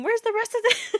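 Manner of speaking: high disbelieving squeal; incredulous. Real, not acted. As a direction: demand where the lines went. Reacting to missing prophecy.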